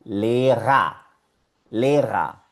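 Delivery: "lera" with long E, final A; German pronunciation demo. Shown as a demonstration. Teacher testing the rule on the next word, clear "le-ra". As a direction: model lera as two clear syllables. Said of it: "se cumple la regla".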